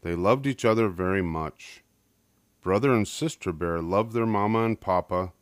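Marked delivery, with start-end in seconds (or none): none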